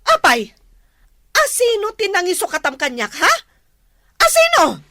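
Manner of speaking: rising furious questioning, ending in a high shout; shocked wife shouting at husband; angry demand. Acted. Wife confronts leaving husband.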